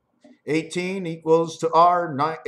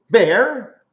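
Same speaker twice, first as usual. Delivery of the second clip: bear, louder and higher; loud punchline; 6 semitones higher, 9 dB louder than usual. Acted. Riffing on letters, he bursts out the word bear.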